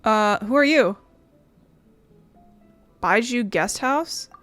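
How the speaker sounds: usual speaking voice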